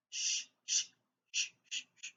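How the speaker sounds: soft hushing sh, repeated; quiet repeated shh; sh sound, whispered. Shown as a demonstration. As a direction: model the sh blend for kids. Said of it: "What blend is that?".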